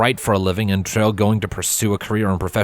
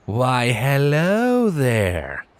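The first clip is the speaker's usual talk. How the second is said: slow, drawn-out flirty hello; flirty greeting; 3 semitones higher, much slower than usual. Acted. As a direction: lay on the charm, slowly. Flirting with Twilight, eyebrow waggle.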